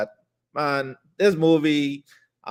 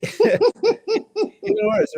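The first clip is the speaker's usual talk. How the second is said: squealing with laughter through the words; laughing; much slower than usual. Real, not acted. Laughing to co-host about the British casting, squealed words.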